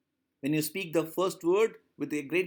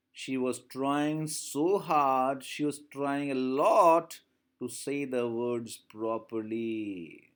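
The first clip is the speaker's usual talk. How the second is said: slow emphasis; 3 semitones lower, much slower than usual. Shown as a demonstration. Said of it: "teacher stretching words for emphasis".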